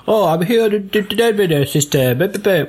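high, stuttering hologram message; garbled mock transmission, pitched high; glitchy hologram. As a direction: break up into gibberish like a bad transmission.

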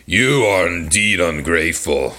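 storybook beast's hammed-up rebuke; shouting beast. A narrator's reading. Beast scolding the merchant, mild theatrical shout. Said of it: "shouted the beast".

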